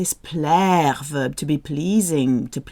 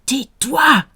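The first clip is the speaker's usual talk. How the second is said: loud, higher snapped order; sharp command; 6 semitones higher, 5 dB louder than usual. Shown as a demonstration. Teacher acting out telling someone to shut up.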